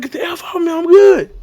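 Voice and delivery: high strained mock protest; distressed refusal. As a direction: insist on being left alone, high-pitched. Re-enacts refusing help on field.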